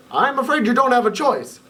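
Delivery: high, lightly voiced old professor. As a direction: break the news gently as the professor. Professor breaks bad news.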